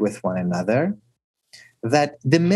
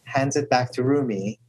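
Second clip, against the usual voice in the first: ordinary delivery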